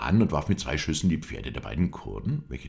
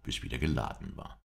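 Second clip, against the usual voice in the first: usual speaking voice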